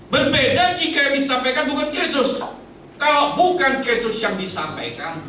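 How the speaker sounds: high, emphatic pulpit warning; forceful preaching. Real, not acted. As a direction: proclaim it firmly to the congregation.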